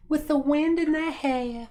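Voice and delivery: high, slow Southern belle voice. Pretend. Redoing the porch line as a belle, high slow Southern belle voice. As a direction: float it dreamily like a Georgia belle. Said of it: "They all talk like Southern belles".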